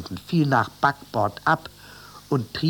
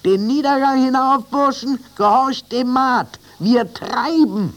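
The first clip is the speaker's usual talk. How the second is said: high, loud, slowing at the end; shouted commands; 11 semitones higher, 6 dB louder than usual. A narrator's reading. Captain sending animals up to the mate, high loud shouts. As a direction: urge them up the stairs. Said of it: "rief er".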